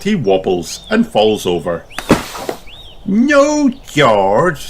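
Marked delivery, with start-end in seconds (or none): 3.0-4.7 Peppa voice. A narrator's reading. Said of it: "says Peppa".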